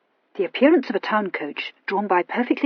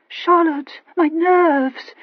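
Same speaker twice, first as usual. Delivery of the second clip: fading voice; 8 semitones higher, 4 dB louder, much slower than usual. A narrator's reading. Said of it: "she said in a fading voice".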